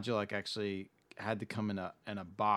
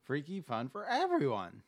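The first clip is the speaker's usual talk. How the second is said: toy commercial; 6 semitones higher than usual. Retold or imitated. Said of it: "do that voice".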